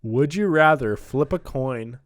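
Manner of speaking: ordinary delivery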